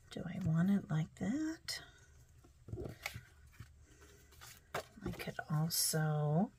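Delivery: soft unvoiced whisper to herself; hushed whisper while working. Real, not acted. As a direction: think aloud under your breath. Muttering to herself while crafting.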